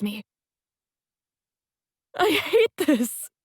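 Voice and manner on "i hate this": high, slow, sobbing words; through sobs. A narrator's reading. Heroine sobbing to Sebastian in his arms, tearful. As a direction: choke it out through tears. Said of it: "I say through sobs".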